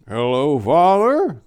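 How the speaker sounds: a hint of a quavery old husband; wavering old man. A narrator's reading. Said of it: "came the wavering old voice of Mister Pickles".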